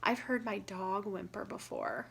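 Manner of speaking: usual speaking voice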